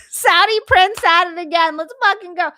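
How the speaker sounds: loud, high hype over the gifts; excited cheer. Real, not acted. Hype over a hundred gifted subs.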